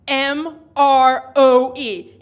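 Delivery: slow and loud, each letter spelled out; loud all-caps read. Acted. Reading the stranger's all-caps message, slow and loud. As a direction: belt out the letters like shouting caps.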